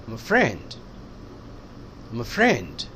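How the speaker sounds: friend stressed and falling, I'm a said light and quick. Shown as a demonstration. Teacher modelling stress on the noun for students. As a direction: say it low, put the weight on friend. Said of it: "friend पे stress दिया".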